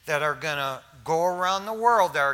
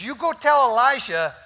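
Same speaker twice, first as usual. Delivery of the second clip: threatening call; 5 semitones higher, 8 dB louder than usual. Retold or imitated. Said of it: "She says".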